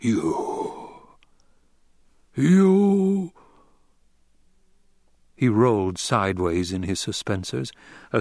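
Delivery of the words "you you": rising, horrified cry of you; the dying Baron's disbelieving cry; shocked cry. Acted. Baron stung by poison.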